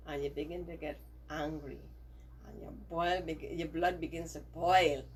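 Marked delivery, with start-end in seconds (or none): none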